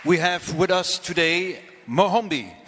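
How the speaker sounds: ordinary talk, nothing marked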